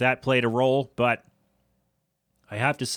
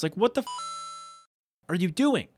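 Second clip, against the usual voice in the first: exasperated disbelief; 5 semitones higher, 3 dB quieter than usual. Real, not acted. Exasperated at mom's photos.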